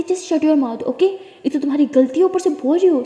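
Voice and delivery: high, loud angry rebuke; mother yelling at her daughter to shut up. Acted.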